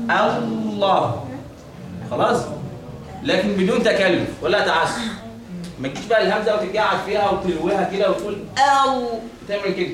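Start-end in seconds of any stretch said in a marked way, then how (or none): 0.1-1.3 الله stretched long, heavy lam; drawn-out model. Shown as a demonstration. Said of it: "تقول إيه؟". 8.1-9.9 أو with the hamza dwelt on and bent; wrong twisted hamza. Shown as a demonstration. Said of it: "دي خطأ".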